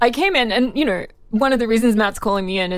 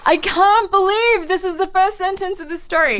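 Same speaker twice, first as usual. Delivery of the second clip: high-pitched, incredulous outburst; amused disbelief; 11 semitones higher than usual. Real, not acted. Reader reacts to article's punning first sentence, amused disbelief. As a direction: exclaim it in scandalised disbelief, much higher.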